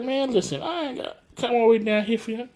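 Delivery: hammed-up exasperated whine; high mock exasperation. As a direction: squeak out the complaint fast. Steve voiced high, exasperated.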